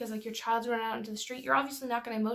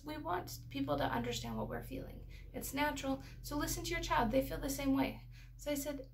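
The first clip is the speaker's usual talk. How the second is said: ordinary delivery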